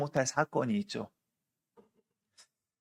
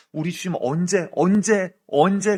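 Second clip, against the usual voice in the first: each 언제 louder and higher, climbing to a cry; building urgent plea; 4 semitones higher, 9 dB louder than usual. Real, not acted. Preacher asking the congregation when the Lord will act, building louder. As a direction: press the question harder every time.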